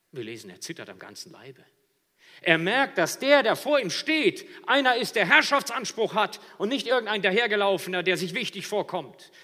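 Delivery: loud, high, soaring sermon voice; full-force preaching. Real, not acted. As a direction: build the scene with full preaching power. (predigen mit voller Kraft)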